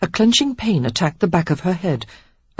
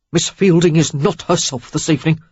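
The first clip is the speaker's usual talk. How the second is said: terse; 4 dB louder than usual. A narrator's reading. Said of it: "Worthy said tersely".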